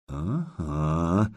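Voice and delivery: ordinary delivery, nothing marked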